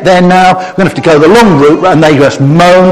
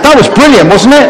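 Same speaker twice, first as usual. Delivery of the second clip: higher and louder, thrilled; excited praise; 9 semitones higher, 4 dB louder than usual. Real, not acted. Praising the children's snake-bite impressions, excited. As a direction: cheer them on with delight.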